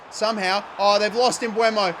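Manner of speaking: ordinary delivery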